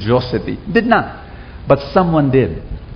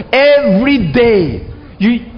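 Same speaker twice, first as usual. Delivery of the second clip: lifted, stretched out and urgent; high emphatic cry; 7 semitones higher, slower than usual. Real, not acted. Preacher promising the congregation, high and drawn out. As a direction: sing it out to the whole hall.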